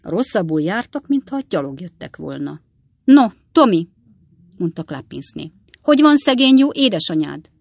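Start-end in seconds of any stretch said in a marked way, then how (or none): 3.0-3.9 put-on higher, louder grown-up addressing a child; effusive greeting. A narrator's reading. Klappinsné greets young Tommy, effusive. 5.8-7.5 put-on high, loud fussing over a child; effusive inquiry. A narrator's reading. Klappinsné asks about Tommy's poor mother, effusive.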